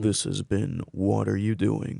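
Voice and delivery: slow radio sign-off; lightly put-on announcer read. Shown as a demonstration. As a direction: close the show like a radio host. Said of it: "I could definitely turn it on".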